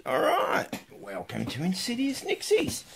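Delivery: bright call. Real, not acted. Cheery on-camera greeting.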